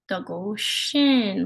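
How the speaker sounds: slow, careful Ojibwe: Dago Shin, each syllable clear; teacher sounding out Dago Shin; Ojibwe word said slowly. Shown as a demonstration. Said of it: "our word is Dago Shin".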